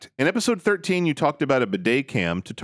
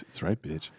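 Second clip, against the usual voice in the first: low, much softer macho grunt; low mock husband; 10 semitones lower, 11 dB quieter than usual. Husband answering his wife, low and much softer. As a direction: mumble it deep.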